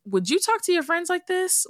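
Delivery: high-pitched imitation of mom, lightly voiced; mom's gentle question. Recalling mom asking while she recorded, mock high mom voice. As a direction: playfully voice mom's gentle nudge. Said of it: "And she said".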